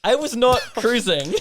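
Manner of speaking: slow, loud, laughing protest; laughing denial. Real, not acted. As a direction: deny it through a laugh. Denying the tease again.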